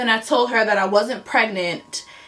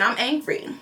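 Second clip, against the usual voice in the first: usual speaking voice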